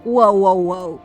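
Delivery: ordinary talk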